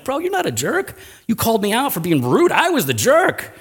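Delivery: high-pitched retold protest; emphatic protest. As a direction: insist on taking the blame, voice way up. Retold protest, taking the blame.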